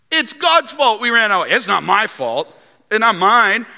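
high, loud mock protest; defensive blame-shifting. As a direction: protest your innocence like a cornered king. Mimics a king dodging blame.

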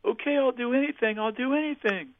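high-pitched retold yell at ordinary volume; mock yelling. Woman calling after the departing helper, high mock yell. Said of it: "she yells".

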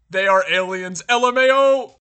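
loud, escalating read-out; shouted chat comment. Acted. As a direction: yell it like an over-excited viewer. Reads an all-out chat comment aloud.

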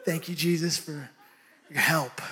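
soft, low thankful murmur; relieved aside. Real, not acted. Preacher thanking Jesus under his breath, soft and low.

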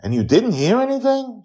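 put-on high, loud child questioning; a small girl asking, way up high; Joy asks Mastermind, high girl voice; little girl voice. A narrator's reading.

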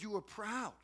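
ordinary talk

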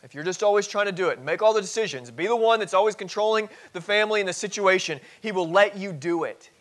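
loud, high, driving sermon delivery; impassioned warning at full pulpit volume; forceful preaching. Real, not acted.

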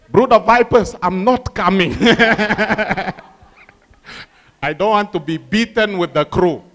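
loud, laughing mock refusal; playful loud ribbing while laughing; laughing disciple. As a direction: joke through the laughter. Disciples joke about skipping sermon.

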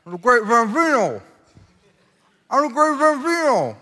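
put-on high, muffled, unintelligible kid voice; garbled Porter impression. Preacher imitating Porter trying to act cool, garbled. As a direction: mangle the words in a high-pitched mock voice. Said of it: "Can't understand him".

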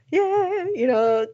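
mock greeting. Imitating greetings nobody gave downtown, mock friendly greeting. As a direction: call it out like a friendly passer-by.

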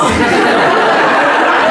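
breathy open laugh; loud amused laugh; burst of laughter. Real, not acted. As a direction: let a big laugh out. Laughing at the mix-up.

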